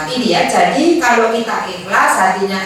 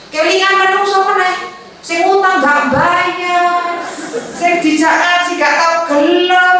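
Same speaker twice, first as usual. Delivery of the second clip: irritated complaint; 7 semitones higher than usual. Acted. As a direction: vent like someone who has had enough. Acts fed-up, irritated person. (menggerutu kesal dengan nada tinggi)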